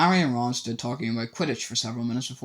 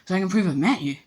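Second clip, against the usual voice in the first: eagerly; 7 semitones higher, 4 dB louder, faster than usual. A narrator's reading. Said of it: "said Colin Creevey, eagerly".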